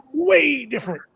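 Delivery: WAY pitched high and drawn out; emphatic contrast. Real, not acted. Seller reacting to a far smaller order, emphatic.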